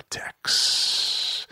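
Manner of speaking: mysterious whispered name; whispered patron name for effect. Real, not acted. Reading patron names.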